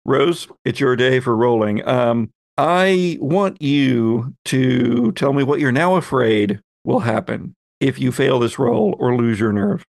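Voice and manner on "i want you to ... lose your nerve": ordinary talk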